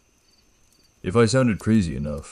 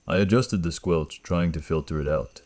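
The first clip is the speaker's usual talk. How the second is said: ordinary delivery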